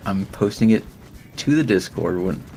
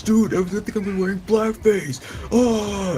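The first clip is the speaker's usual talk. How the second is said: much higher, whiny mock panic; imitating upset friend; 12 semitones higher, slower than usual. Mocking a friend upset about the photoshop, high whiny voice. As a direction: squeak it like a friend freaking out. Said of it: "he's like".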